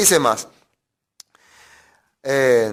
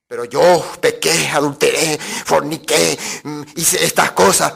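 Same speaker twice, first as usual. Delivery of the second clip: slow, drawn-out, lower mock testimony; mock confession; 5 semitones lower than usual. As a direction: stretch out each sin like a hammed-up confession. Parodia una confesión jactanciosa de pecados. (confesión fingida, lenta y exagerada)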